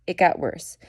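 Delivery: ordinary talk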